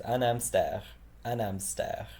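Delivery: "hamster" said with no H, as "amster"; French "hamster" without the H; silent H. Shown as a demonstration. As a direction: drop the H in "hamster". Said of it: "don't really say the H".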